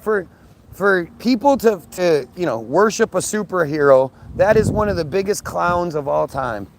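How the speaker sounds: usual speaking voice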